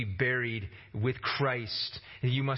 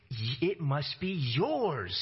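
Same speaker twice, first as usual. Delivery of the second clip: usual speaking voice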